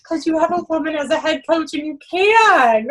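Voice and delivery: playful retort. Retold or imitated. Coach's retort to swimmer.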